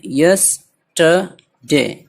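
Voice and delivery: Yesterday very slowly, in three separate parts; syllable by syllable. Shown as a demonstration. Teacher counting three parts, yesterday syllable by syllable. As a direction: split yesterday into yes, ta, de with gaps. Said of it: "तीन है इसमें".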